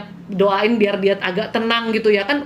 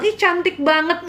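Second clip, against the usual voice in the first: higher, drawn-out gushing compliment; mock sweet-talk; 6 semitones higher than usual. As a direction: imitate a sweet-talker gushing. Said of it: "bukan orang yang mulut manis".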